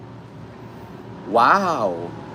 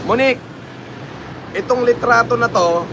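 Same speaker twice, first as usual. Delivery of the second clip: calling out; 6 semitones higher than usual. Real, not acted. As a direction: call out to Monique off-mic. Calling out to staff.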